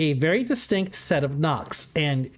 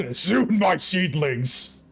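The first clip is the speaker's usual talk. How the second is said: slow, drawn-out demonic villain line; possessed; 4 semitones higher, much slower than usual. Pretend. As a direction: stretch it out like an evil plant lord. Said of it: "you just hear a demonic voice".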